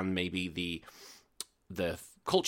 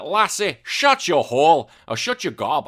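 loud mock Scottish accent, higher pitch; 6 semitones higher, 8 dB louder than usual. Imitating Cait Sith in a loud Scottish accent. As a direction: bark it like a cheeky Scottish cat. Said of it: "Cait Sith had ... a Scottish accent".

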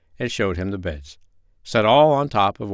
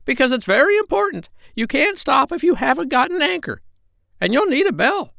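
very high, squawky parrot voice; squawking parrot voice. Acted. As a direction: squawk it out like a bossy old parrot. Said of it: "said the parrot".

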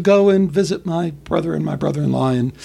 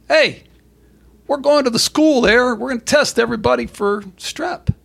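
higher, upbeat official announcement; mock cheery authority, pitched up; 7 semitones higher than usual. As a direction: officials breezily announcing school testing. Officials voiced in retold scenario.

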